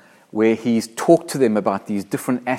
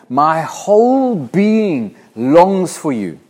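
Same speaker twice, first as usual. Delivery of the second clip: slow longing; 5 semitones higher, 6 dB louder, much slower than usual. Acted. Preacher reading psalm with feeling.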